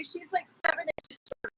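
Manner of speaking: normal talking voice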